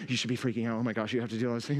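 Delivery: low, hushed inner voice of worry; voicing my anxious heart. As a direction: let the fretful heart murmur low. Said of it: "my heart is talking to me".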